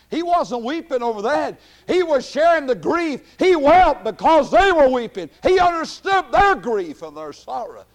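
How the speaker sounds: high, loud shouted preaching; fervent preaching. Acted. Preacher to congregation about Jesus' grief, shouting. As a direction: build it to a shouting climax.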